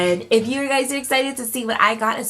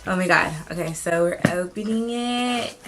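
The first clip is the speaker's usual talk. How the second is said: ordinary talk, nothing marked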